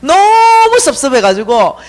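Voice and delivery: normal talking voice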